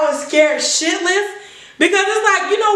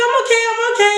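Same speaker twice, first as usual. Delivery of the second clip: higher, hurried I'm fine; mock reassurance; 8 semitones higher, much faster than usual. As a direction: brush them off quickly, pitched up. Reassuring attendants after the fall.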